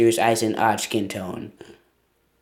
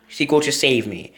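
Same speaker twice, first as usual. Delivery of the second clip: ordinary talk, nothing marked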